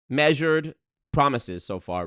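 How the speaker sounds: ordinary delivery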